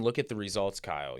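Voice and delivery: normal talking voice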